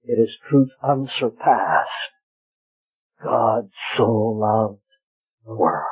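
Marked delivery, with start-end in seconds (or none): 3.2-5.9 each word drawn out low and slow; slow solemn text. Acted. Preacher savouring the verse for his hearers, slow and low. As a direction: let the verse sink in, very slowly.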